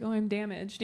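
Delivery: small, pitiful little voice saying it; half-joking sad confession; mock damaged whimper. Shown as a demonstration. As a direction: play it wounded and fragile. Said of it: "I say, like".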